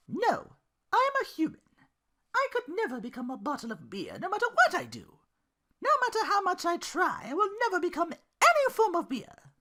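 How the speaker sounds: high, loud put-on girl voice with a laugh; Belle's exasperated reply. Belle telling Duke she can't become beer, high and amused. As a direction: insist flatly, amused.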